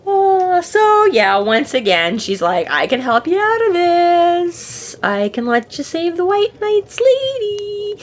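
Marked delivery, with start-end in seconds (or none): 2.7-8.0 high, slow, drawn-out offer; coaxing temptress. Retold or imitated. Fairy offers wizard a bargain, slow high coaxing. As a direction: imitate a fairy sweetly luring with help.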